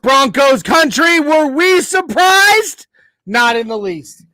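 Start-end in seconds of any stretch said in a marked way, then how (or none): 0.0-2.9 rallying call. Acted. Host rhetorically rallying the fans.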